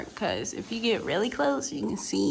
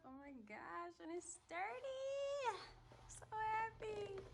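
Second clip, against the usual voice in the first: excited; 12 semitones higher, 17 dB quieter, slower than usual. Real, not acted. Light fixture finally up.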